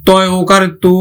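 ordinary delivery

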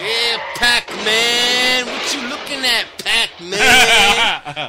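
loud, high, drawn-out mock movie taunt; movie character taunt. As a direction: stretch it out like a hammed-up street tough. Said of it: "Remember Colors? What a great movie".

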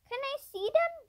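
high-pitched pleading question; beg, with the pitch lifted high; begging. A narrator's reading. Mister Alden asking to see his grandchildren, begging. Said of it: "begged Mister Alden".

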